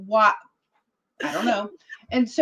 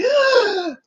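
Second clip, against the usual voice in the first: very high, loud acted-out gasp; startled gasp; 6 dB louder than usual. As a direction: gasp as if shoved forward. Staffer pushes her toward president.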